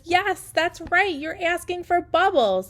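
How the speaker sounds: put-on high, slow, bright praise to a child; enthusiastic praise. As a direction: cheer her on, drawing it out. Therapist praising child's request.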